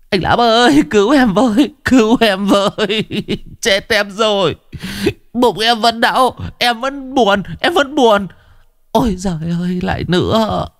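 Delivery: high comic begging; pleading Chí. Acted.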